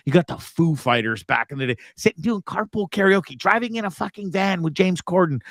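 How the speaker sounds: normal talking voice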